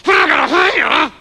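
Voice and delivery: mumbled through knife. Acted.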